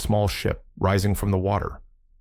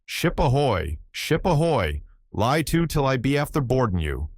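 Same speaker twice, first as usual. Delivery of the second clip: higher-pitched sailor's call; excited hail; 6 semitones higher than usual. A narrator's reading. Sailor hailing the distant ship, excited call.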